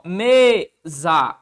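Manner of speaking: "Mesa" split, with a loud, held ME; stress on ME. Shown as a demonstration. Teacher showing the tonic syllable, stressed first syllable of "Mesa". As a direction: hit the ME of "Mesa", then let za fall. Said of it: "Qual a sílaba tônica, rapaziada?".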